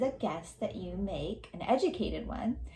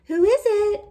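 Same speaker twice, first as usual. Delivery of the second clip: put-on much higher, louder child's call; 14 semitones higher, 14 dB louder than usual. Eldest child asking through the latched door, high and loud.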